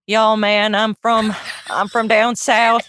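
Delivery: put-on easygoing Southern farmer accent. Host imagining a beret-wearing Frenchman who talks Southern, Southern accent. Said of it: "looking very French, but being like".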